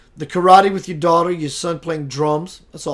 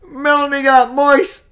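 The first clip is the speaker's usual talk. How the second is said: higher, drawn-out playful tease; slow, teasing repeat for the viewer; 8 semitones higher, 4 dB louder, slower than usual. Real, not acted. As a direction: savor it mischievously.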